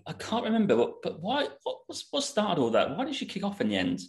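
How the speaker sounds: high, baffled questions from a friend; a lightly voiced confused friend asking why; puzzled friend. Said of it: "she's like".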